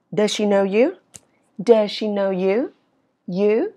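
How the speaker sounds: "you" lifted at the end; rising question tune. Shown as a demonstration. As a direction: ask with "you" going up. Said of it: "ngữ điệu ở cuối câu nó đi lên". ("you" lên giọng cuối câu)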